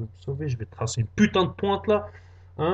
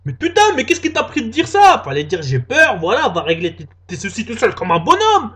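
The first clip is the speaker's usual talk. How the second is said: exasperated self-scolding; 4 semitones higher, 7 dB louder than usual. Acted.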